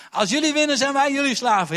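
high, loud mocking challenge; Goliath shouting his duel terms at Israel; giant's taunt. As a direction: bellow the deal at them. Goliath continues the deal.

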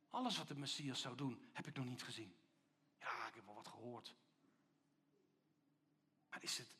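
low, soft voice of creeping doubt; nagging inner voice. Acted. Little voice working on Johannes in prison, low and doubting. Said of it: "een stemmetje die tegen hem zegt".

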